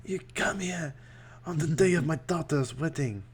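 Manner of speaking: whispered godfather voice; mock godfather whisper; raspy mafia don. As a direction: rasp it out like a movie mafia boss. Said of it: "French-ish".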